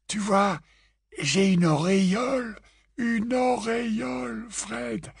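high, drawn-out feeble voice; weak wounded twin. A narrator's reading. George explains his joke to Fred, weak and fading. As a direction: draw it out, fading softly at the end.

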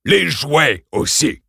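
slow, imperious order; commanding principal. Acted. Principal orders the children, slow and imperious. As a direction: crack it like a whip. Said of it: "ordonna-t-il".

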